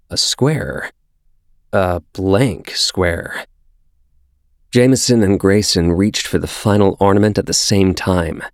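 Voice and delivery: ordinary delivery, nothing marked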